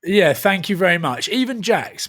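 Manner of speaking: ordinary talk, nothing marked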